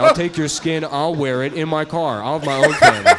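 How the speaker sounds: hammed-up unhinged outburst; mock deranged threat. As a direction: escalate the absurd threats into hammed-up ranting. Mock deranged threat to condescending husband.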